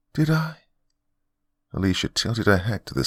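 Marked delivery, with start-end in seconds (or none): 0.1-0.6 innocent surprise. Acted. Android maid innocently questioning.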